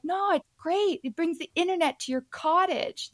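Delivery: high, upbeat selling voice; eager pitch. Retold or imitated. Promoter pushing Wi-Fi on skeptical cottagers, high and eager. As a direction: sell it with bright enthusiasm.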